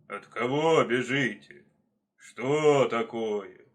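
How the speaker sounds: slow, loud bear voice; heavy, drawn-out questioning. Acted. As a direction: boom it out with long vowels. Bear questions the fleeing animals.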